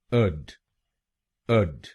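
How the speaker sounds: अड said short, like "ud"; syllable demo. Shown as a demonstration. Teacher sounding out the first syllable for students, slowly. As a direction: say अड with a short neutral vowel. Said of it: "एडी हम बोलेंगे".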